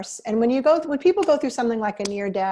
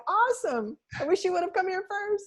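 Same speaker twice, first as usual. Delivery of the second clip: higher, bright mock-cheery thought voice; silent cheeky reply; 10 semitones higher than usual. Hypnotherapist secretly answering a desperate client, much higher and playful. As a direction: play the inner answer with an amused eye-roll. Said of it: "I say it silently".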